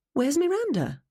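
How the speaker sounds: high-pitched, alarmed question; sudden alarm. A narrator's reading. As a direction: ask it with the pitch leaping up. Narrator suddenly notices someone missing.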